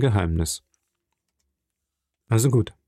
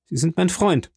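tense, higher-pitched insistence; pressed lips; 7 semitones higher than usual. Acted. Angry viscount reminds the journalist of friendship, tensely. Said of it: "fuhr Albert mit gepressten Lippen fort".

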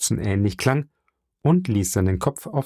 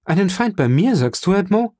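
raised, high-pitched indignant questioning; indignant cry; 10 semitones higher, 3 dB louder than usual. A narrator's reading. Mercedes confronts Edmond over the word enemy, indignant. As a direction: challenge him in affronted disbelief. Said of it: "rief Mercedes mit einem zornigen Blick".

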